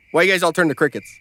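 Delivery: normal talking voice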